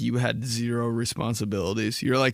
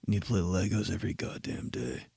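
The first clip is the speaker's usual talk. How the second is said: intense whisper, softer; close whisper; 5 semitones lower, 7 dB quieter than usual. Retold or imitated. Man whispering to the kid in the Target, softer and intense. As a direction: whisper it like a life lesson. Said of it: "get him real close to my face".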